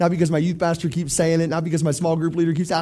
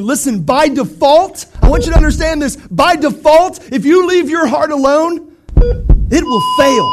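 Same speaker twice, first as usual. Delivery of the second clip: preaching at full force; 7 semitones higher, 6 dB louder, slower than usual. Real, not acted. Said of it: "preaching climax, slow and loud".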